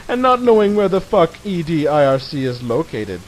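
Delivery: ordinary talk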